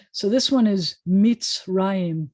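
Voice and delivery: Mitzrayim said slowly and loudly, mits-RA-yim; slow careful pronunciation. Shown as a demonstration. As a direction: spell out Mitzrayim syllable by syllable. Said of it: "That's how you say Egypt in Hebrew".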